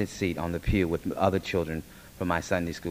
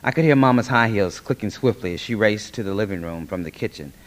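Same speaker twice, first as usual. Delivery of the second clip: plain reading voice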